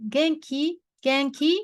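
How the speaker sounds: Genki, genki with pitch rising at the end like a question; rising question tune. Shown as a demonstration. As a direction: ask Genki with the voice going up. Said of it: "you intonation go up".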